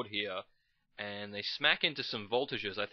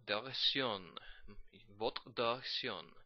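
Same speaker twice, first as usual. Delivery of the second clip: hammed-up French accent, lightly done; mock French taunt; 2 semitones higher than usual. To the enemy, a mock French taunter's accent. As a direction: sneer it like a Monty Python Frenchman.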